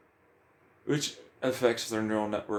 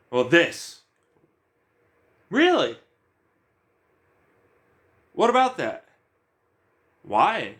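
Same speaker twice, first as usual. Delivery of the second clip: mock challenge; 6 semitones higher, 4 dB louder than usual. Challenging questions to himself.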